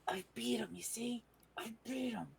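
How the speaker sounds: high, breath-only stage whisper. Acted. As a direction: whisper a proud secret to a companion. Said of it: "like, whisper to Maggie".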